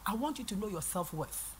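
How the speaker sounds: ordinary talk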